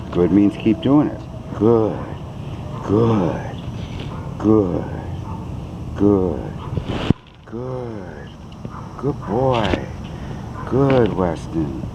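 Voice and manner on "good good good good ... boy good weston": drawn-out calm praise; slow soothing praise. Real, not acted. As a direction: stretch the praise out gently.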